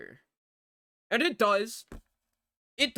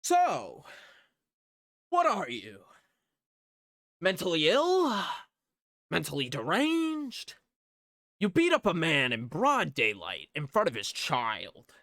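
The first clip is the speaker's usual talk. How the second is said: mock-incredulous boss, swooping pitch; incredulous berating; 2 semitones higher, 4 dB louder than usual. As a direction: berate him in a theatrical, disbelieving voice. Boss berating him in retold scene.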